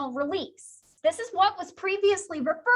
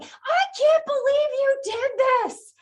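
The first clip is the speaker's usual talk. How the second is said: high, loud mock yelling at the class; 11 semitones higher, 6 dB louder than usual. Teacher pretending to yell at class over exams, loud and high. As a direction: scold the class over a bad exam, hammed-up. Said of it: "yelling at you like".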